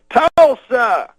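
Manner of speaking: high, loud mock stage shout; Bill Cosby. Cosby baiting the crowd with the rival, loud impression. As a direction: call it out to get a crowd booing. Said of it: "Then he goes".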